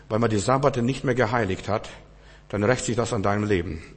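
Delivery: ordinary talk, nothing marked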